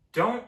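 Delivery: "don't" punched high and loud; a sharp, loud "don't"; emphatic don't. Real, not acted. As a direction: hit "don't" hard like a warning. Emphatic advice on skipping school.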